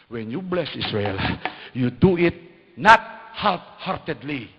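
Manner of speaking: loud, slow emphatic exhortation; forceful preaching. Real, not acted. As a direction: drive the point home with force. (malakas na pangangaral)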